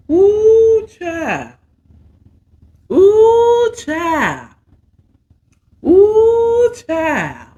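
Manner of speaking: swooning shriek of delight; squealing like she can't handle it. Real, not acted. Overcome by the steamy plot.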